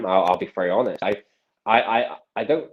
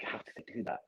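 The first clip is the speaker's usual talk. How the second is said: very soft, whispered wonder; hushed amazement; 17 dB quieter than usual. Retold or imitated. Magician recalling tricks he was shown, hushed amazement. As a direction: say it almost without voice, in awe. Retelling his amazed later thoughts.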